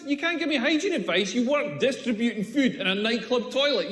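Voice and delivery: normal talking voice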